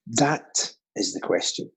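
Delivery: low, slow "that", then a drop through the rest; weight "that" and let it hang; stress on THAT. Shown as a demonstration. Actor offering quiz listeners a reading, stress on "that". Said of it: "Make a choice".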